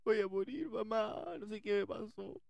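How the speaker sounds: high, slow, drawn-out mock wail for help; stretched comic cry of terror; panicked screaming. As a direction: wail to mom as if dying, hammed up. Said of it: "y yo gritando".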